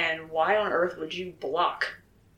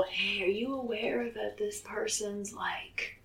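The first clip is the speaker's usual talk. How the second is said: mock gossip whisper; 3 semitones higher, 6 dB quieter, slower than usual.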